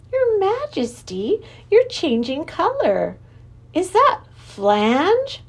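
put-on high, slow, curious girl; girl's voice. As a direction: ask the king gently, a young girl. Lindy voiced asking king.

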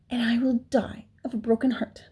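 airy, heartbroken plea; sorrowful piglet voice; desperate. A narrator's reading. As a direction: say it as if the heart is breaking. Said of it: "in desperation".

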